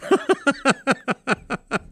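high, rapid chuckling; real amused giggle; laugh. Real, not acted. Uncle recalling great moments, high chuckle.

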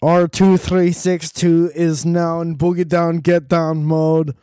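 booming fake robot announcing itself. Robot voiced in the bit.

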